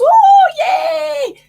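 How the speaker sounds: very high, loud cheering yay; spirit cheering. Retold or imitated. Spirit mother cheering for her daughter, very high and loud. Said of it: "She goes".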